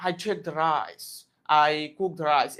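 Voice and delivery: very slow, mouthy British English accent. Shown as a demonstration. Teacher demonstrating the British accent to students, slow British accent. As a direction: round the lips and draw out each word in a British accent. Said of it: "British অ্যাকসেন্টে কথা বলতে".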